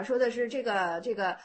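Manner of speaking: ordinary talk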